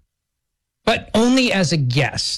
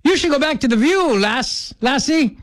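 put-on Irish accent; 7 semitones higher than usual. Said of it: "I don't do an Irish accent".

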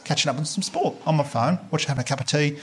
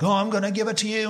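higher-pitched mock lover's voice; hammed-up foreplay talk from a man; foreplay guy; 6 semitones higher than usual. Young man to his girlfriend through the wall, mock foreplay. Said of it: "like the guy's like".

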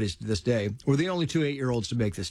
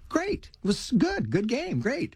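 much higher, casual mock praise from a dad; breezy fatherly pat on the back; offhand dad praise; 10 semitones higher, slower than usual. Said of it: "my dad's saying, like".

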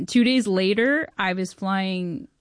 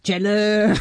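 a hint of a famous comic's drawl; lightly voiced Sandler saying her name; Adam Sandler impression; 4 dB louder than usual. Adam Sandler greeting her on the phone, lightly voiced. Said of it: "It's the real Adam Sandler voice?".

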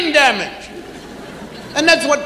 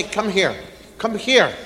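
put-on lower, softer call to a child; low calm call; 7 semitones lower, 7 dB quieter than usual. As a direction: call them in gently, low. Calling a child.

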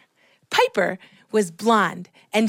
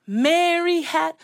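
teacher leading along; 7 semitones higher, slower than usual. Retold or imitated. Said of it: "I'll say it with you".

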